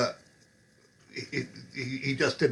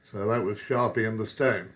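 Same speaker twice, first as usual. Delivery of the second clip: usual speaking voice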